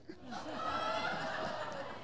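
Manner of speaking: a real burst of unvoiced laughter; breathy laugh. Real, not acted. Preacher laughs at the spy taxi driver, real laughter. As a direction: laugh out at the surprise.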